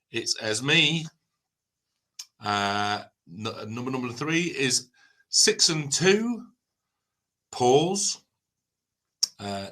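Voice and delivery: drawn-out bingo number calls; bingo caller. Acted. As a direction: tease each number with slow pauses.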